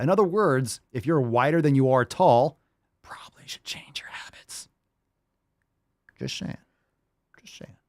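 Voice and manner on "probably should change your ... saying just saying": hushed, whispered punchline; whispered aside. Real, not acted. Reactor slipping advice to viewers under his breath, whispered. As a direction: drop into an unvoiced whisper.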